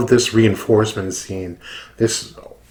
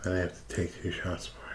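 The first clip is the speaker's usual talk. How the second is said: whispering; 4 semitones lower, 18 dB quieter, faster than usual. Real, not acted. Whispering before drinking shots.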